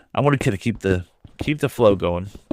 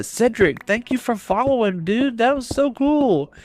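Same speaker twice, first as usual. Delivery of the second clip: high, slow, gushing gratitude; thrilled thanks; 11 semitones higher than usual. Real, not acted. Streamer thanks follower Cedric, thrilled and high. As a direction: savour each word of thanks.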